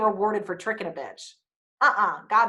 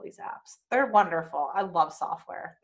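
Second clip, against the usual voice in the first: usual speaking voice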